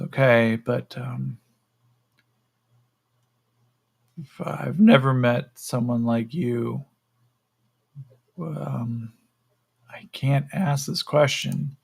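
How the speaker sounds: slow, halting, unfinished thought; lightly voiced captive, pausing before he dares ask; hesitant captive. Yakim struggles to ask the creature, hesitant captive voice.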